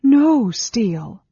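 high, loud, drawn-out protest; crying out. A narrator's reading. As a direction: cry out to deny it, slowly. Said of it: "Sarah cried".

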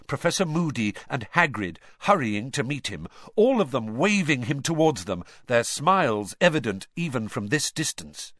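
ordinary delivery

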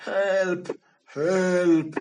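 loud high cry of Help; a trapped lion shouting for help, loud and high. Acted. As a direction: yell it out trapped and desperate. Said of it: "she shouted".

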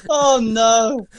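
high, loud wail through laughter; helpless laughter. Real, not acted. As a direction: crack up at your own misunderstanding. Cracking up at own misunderstanding.

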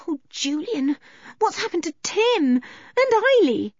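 put-on high, worried child's voice; high-pitched, anxious appeal; panicky. A narrator's reading. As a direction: ask in rising panic. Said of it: "began to be very panicky".

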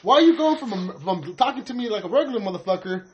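half-hammed retold complaint; mock exasperated rant. Telling off a friend for switching speech styles, high exasperated rant. Retelling his outburst at a friend.

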